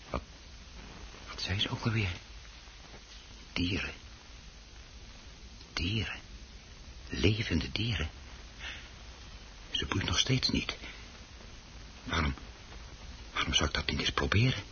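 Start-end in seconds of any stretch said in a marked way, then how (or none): 3.5-3.9 traag, zacht in zichzelf; broed fluisterend op een duister idee; zacht peinzend. Acted. Man broedt alleen op plan. 5.7-6.2 toonloos gefluisterd woord. Acted. Fluistert het idee. 7.1-14.6 traag, zacht in zichzelf; broed fluisterend op een duister idee; zacht peinzend. Acted. Man broedt alleen op plan.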